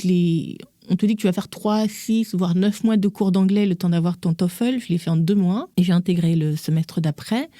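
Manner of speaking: normal talking voice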